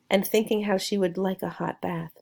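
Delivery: plain reading voice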